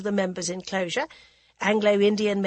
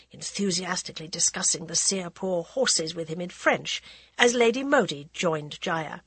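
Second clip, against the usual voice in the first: plain reading voice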